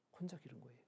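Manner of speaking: normal talking voice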